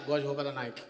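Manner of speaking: low, soft flat echo; mock Ronaldinho. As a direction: answer it dull and low. Ronaldinho answering inquiry.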